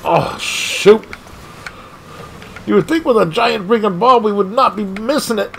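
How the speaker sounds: normal talking voice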